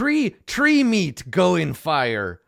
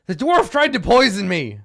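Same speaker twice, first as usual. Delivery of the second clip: offended gnoll; 6 semitones higher, 8 dB louder than usual. Acted. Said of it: "he says".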